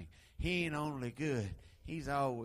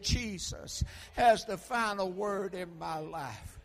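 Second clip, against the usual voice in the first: emphatic preaching; 4 semitones higher, 4 dB louder, slower than usual. Acted. Preaching declaration.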